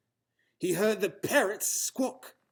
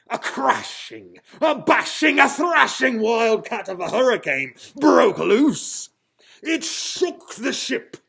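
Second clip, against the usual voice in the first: from brisk to loud, much higher shout; building storm narration; 6 semitones higher, 9 dB louder than usual. A narrator's reading. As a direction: crescendo into the hurricane.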